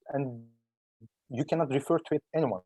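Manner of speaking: usual speaking voice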